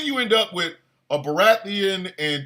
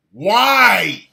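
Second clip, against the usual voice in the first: ordinary talk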